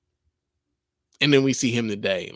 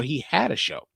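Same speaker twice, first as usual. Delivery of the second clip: whispered correction; 6 dB quieter than usual. Real, not acted. Correcting himself aside.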